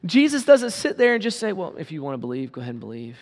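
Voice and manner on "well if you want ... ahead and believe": soft, offhand, couldn't-care-less tone; casual shrug. Retold or imitated. Preacher mocking an indifferent Jesus, soft and offhand. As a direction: toss it off lazily, much softer. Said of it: "No, it says he cried out".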